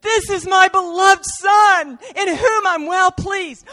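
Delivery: high, loud, slow heavenly declaration; the Father proclaiming at Jesus' baptism; booming proclamation. Acted. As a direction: announce it from the heavens.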